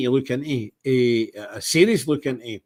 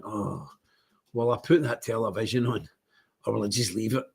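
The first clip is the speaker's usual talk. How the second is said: low, soft weary pondering; reluctant musing; 4 semitones lower, 6 dB quieter than usual. Acted. Host voicing his morning dread of the television, reluctant musing. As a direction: mull it over quietly, half dreading it.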